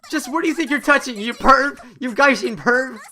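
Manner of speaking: high, loud outraged accusation; indignant girl. Acted. Girl confronts stranger who landed on her, indignant. Voices angry girl after collision.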